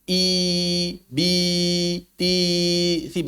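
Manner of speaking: long "I, bi, ti, si" with spread lips; spread-lip kasrah. Shown as a demonstration. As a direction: grin and raise mid-tongue on each "i". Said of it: "Mulut nyengir".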